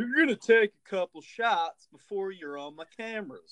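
high-pitched mock talk-show host; Doctor Phil. Mocking the host who boozed a guest, high impression. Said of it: "That's classic Phil".

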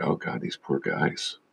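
whispered aside to himself; a soft pitying whisper; inner thought. Retold or imitated. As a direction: breathe it as a private wince. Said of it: "I'm sitting there thinking".

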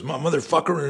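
high-pitched imitation of angry yelling; retold yelling. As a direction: act out a livid outburst without full volume. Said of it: "he starts yelling like".

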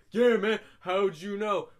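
loud, slow amazed reply; amazed friend. Retold or imitated. Friend amazed the listener guessed, loud and slow. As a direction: act amazed, drawn out.

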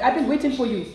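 lightly put-on African accent; a hint of a generic African accent; mock African accent. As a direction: imitate a suitor in a loose African accent. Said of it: "I'm gonna sound just like this".

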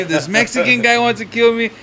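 lightly acted alarm; retold frantic complaint. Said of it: "he's fucking spazzing out".

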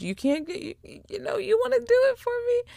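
high, fast, anxious begging voice; a nervous girl begging for help; nervous pleading. Retold or imitated. Nervous Bree begging grandmother.

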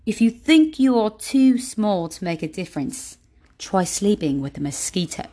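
playful imitation of an author's accent; Jay Shetty's accent. Shown as a demonstration. Podcaster rereading a favorite quote for listeners, imitated Jay Shetty accent. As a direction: put on the author's accent, stressing key words. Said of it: "read it in Jay Shetty's, um, accent".

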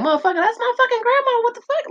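high-pitched, rapid squeal of disbelief; mock-indignant falsetto outburst; outraged inner reaction. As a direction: shoot way up high and rush it, incredulous. Acted outraged thought at ancestor excuse.

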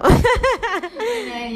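laughing after choking. Real, not acted. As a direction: laugh through the word. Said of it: "Se estaba atragantando".

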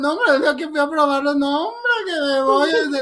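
high whimpering mock sob; half-laughing fake cry. Retelling the dish, high mock weepy voice.

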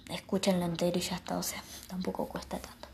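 hushed unvoiced whisper, slightly quick. Real, not acted. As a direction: keep it to a whisper so nobody hears. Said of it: "no puedo hablar tan fuerte".

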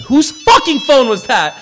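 high, loud, drawn-out demand; slow, indignant yell; outraged outburst. Real, not acted. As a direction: explode at the interruption, stretching the words. Outburst at a phone interrupting him.

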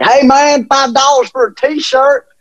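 high, slow hammed-up vendor pitch; mock rally vendor. Rally vendor selling a shirt to a fan, in a high mock voice. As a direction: hawk shirts in a silly high voice. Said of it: "there was a guy like".

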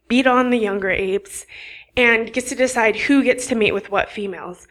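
ordinary talk, nothing marked

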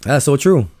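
amazed. Retold or imitated. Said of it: "And I'm like".

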